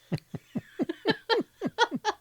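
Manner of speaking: high, helpless real giggling; long laugh. Real, not acted. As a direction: crack up for a couple of seconds. Cracking up at Neil's answer.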